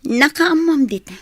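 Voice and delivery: angry outburst. Acted. Wife confronting the other woman, angry loud outburst. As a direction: snap it out, loud and high.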